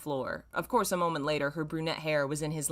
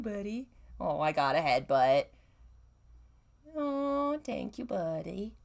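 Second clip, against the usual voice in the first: cooing to cat; 5 semitones higher than usual. Real, not acted. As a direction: coo sweetly at the pet. Cooing at her purring cat.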